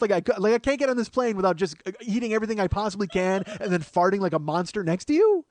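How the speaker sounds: high, loud comic tirade; mock-indignant rant. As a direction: pile up outraged questions at full tilt. Retold sarcastic rant at seatmate.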